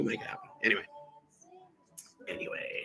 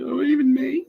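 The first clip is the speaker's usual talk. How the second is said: mock surprise; 7 dB louder than usual. Mock reply from the others.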